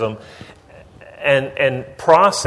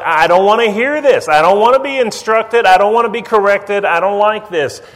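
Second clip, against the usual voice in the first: put-on high, loud whiny child protest; sulky child; 8 semitones higher, 5 dB louder than usual. Child covering ears, refusing to be corrected: high whiny protest. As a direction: whine like a kid refusing correction. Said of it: "But that's like".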